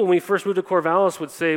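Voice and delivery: normal talking voice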